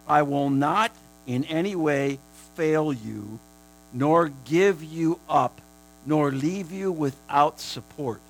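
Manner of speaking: slow, loud emphatic reading; preaching scripture. Acted. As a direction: lean on every word of the promise. Preacher reads scripture with force.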